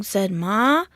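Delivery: usual speaking voice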